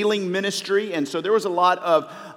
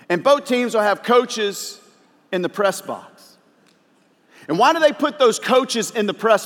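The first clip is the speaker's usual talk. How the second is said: higher and louder preaching build; 7 semitones higher, 4 dB louder than usual. Acted. Pastor urges the church to look at why, preaching with force. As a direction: lift the congregation up to the press box. Super Bowl coaching illustration.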